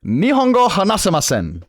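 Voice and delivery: emphatic, high-pitched Japanese declaration; confident Japanese. Shown as a demonstration. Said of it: "With absolute confidence".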